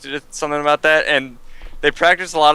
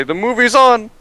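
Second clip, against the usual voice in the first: higher, louder mock Ugandan narrator; mock Ugandan movie accent; 9 semitones higher, 5 dB louder, slower than usual. Friend imitates a Wakaliwood narrator to his friend, loud mock accent. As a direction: shout it like an excited Wakaliwood narrator. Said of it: "you gotta just go say".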